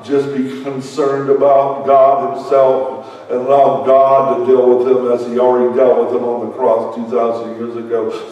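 usual speaking voice

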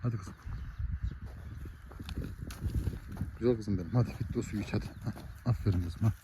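quiet, gentle coaxing of a cow; soft coaxing. Real, not acted. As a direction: softly urge her to drink.

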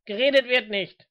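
loud, high official order; barked police command. Acted. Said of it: "befahl der F-B-I-Beamte".

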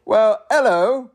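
slow, high, loud British hello, lifting at the end; snooty British accent retry. Acted. Narrator retrying the raccoon's greeting, hammed-up British accent. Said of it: "Should I try that again".

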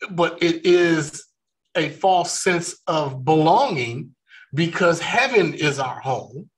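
ordinary talk

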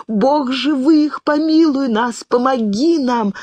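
high, slow desperate prayer; frightened girl babbling a prayer. A narrator's reading. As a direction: cry out to God in helpless fear. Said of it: "бессознательно лепетала Кэт".

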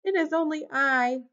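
high little goat voice answering up; high-pitched, loud meek reply. A narrator's reading. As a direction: pipe up as a small goat. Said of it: "said the goat".

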